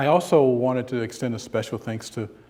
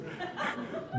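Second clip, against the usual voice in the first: short unvoiced chuckle; a quick laugh under the breath; breathy laugh; 9 dB quieter than usual. Real, not acted. Speaker laughs while telling the airplane story.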